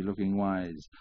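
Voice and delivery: ordinary delivery, nothing marked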